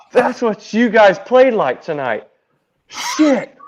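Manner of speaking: loud, high mock outburst; manager's loud retold scolding. Manager berates team after his demonstration.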